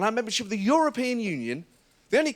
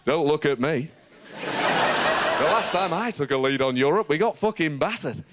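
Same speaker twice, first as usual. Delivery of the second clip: lower, slow, defensive voice; a lightly done William Hague grumble; low and dragged-out, pinning the blame elsewhere; William Hague impression; 4 semitones lower than usual. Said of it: "imagine poor William Hague there going".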